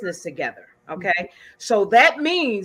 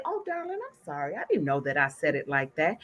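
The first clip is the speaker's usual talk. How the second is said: sweet apology; 6 semitones lower, 8 dB quieter, faster than usual. Retold or imitated. Sweetly apologizing to her partner.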